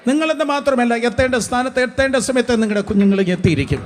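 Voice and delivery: loud, high-pitched rallying proclamation; full-force preaching. Real, not acted. Preacher assuring listeners they and their children will arrive, full-force proclamation. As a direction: build to a forceful sermon climax.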